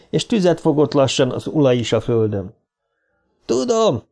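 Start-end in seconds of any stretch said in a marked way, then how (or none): 3.4-4.0 high-pitched cry, falling at the end; yelling. A narrator's reading. Dick insists to doubting Trev, yelling. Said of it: "üvöltötte Dick".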